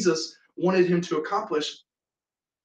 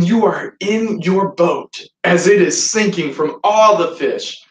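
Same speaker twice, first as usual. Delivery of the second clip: ordinary talk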